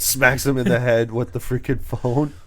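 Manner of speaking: ordinary delivery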